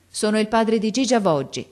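a father presenting himself urgently; eager announcement. A narrator's reading. Gardener announces himself to the custodian, eagerly.

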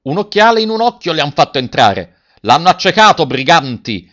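loud, high furious cry; outraged shout. A narrator's reading. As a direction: rail at the culprits. Crowd outraged at the injury.

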